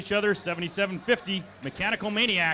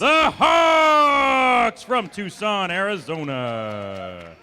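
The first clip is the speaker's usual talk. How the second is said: slow, drawn-out, louder hype intro; fighting game announcer; 5 dB louder, much slower than usual. Acted. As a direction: stretch every syllable like a ring announcer. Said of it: "game announcer".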